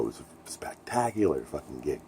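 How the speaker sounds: hushed, excited whisper; breathless whisper about a great gig. Real, not acted. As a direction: lean in and whisper it like a secret.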